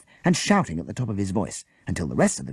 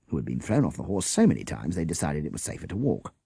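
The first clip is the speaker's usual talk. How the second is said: ordinary delivery, nothing marked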